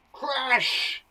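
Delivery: high, drawn-out CRASH; stretched crash with a long hissing end; crashing sound. Shown as a demonstration. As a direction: make the word sound like a collision. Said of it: "it sounds like the action".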